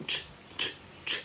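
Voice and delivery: "Ch" released as a bare voiceless burst; popped ch. Shown as a demonstration. Teacher showing how to release a final sound, "Ch" popped. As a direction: pop each "Ch" out without a vowel. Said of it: "Bật ra".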